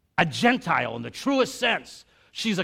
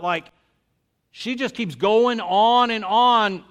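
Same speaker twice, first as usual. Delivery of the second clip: fervent preaching; 4 semitones higher, 6 dB louder than usual. Acted. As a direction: preach it out to the congregation. Preaching at full force.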